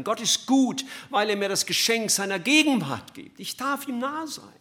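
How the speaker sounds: high and loud, rising into jubilation; ecstatic proclamation. Real, not acted. Preacher rejoicing before his congregation, high and loud. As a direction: shout the joy of it to the rafters.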